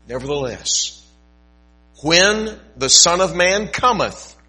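slow, solemn Bible reading with swells; scripture reading. Acted. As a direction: read the Gospel like a preacher, lingering on words. Pastor reads scripture aloud.

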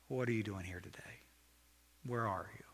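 soft, low, gentle question; loving and kind. Acted. God asking where you are today, loving and kind. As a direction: ask it tenderly, keeping it low and soft. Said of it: "Consider God in a loving, kind heart".

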